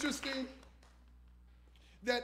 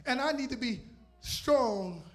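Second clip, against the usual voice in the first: loud driving sermon cadence; preaching at full force; 2 semitones higher, 6 dB louder than usual. Acted. Preacher testifying to the congregation, building fervour. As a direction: preach it out with fire.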